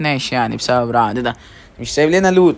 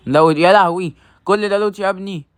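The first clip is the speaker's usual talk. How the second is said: higher, louder cry of disbelief; shocked outcry; 6 semitones higher, 4 dB louder than usual. Real, not acted. Gamer shocked at friend hoarding loot, outcry. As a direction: exclaim in mock outrage.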